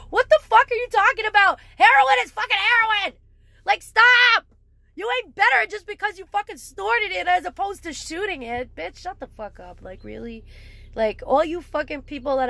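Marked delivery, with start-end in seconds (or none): 0.1-7.1 higher and louder, yelling in outrage; angry shout. Real, not acted. Furious at people ranking heroin use, angry shout. Ranting at posers. 8.8-10.4 muttered dismissal. Real, not acted. Sudden drop after yelling.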